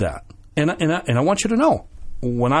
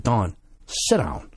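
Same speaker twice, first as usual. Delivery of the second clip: stern; 6 semitones lower, 4 dB quieter, much slower than usual. Retold or imitated. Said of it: "And I got stern with him".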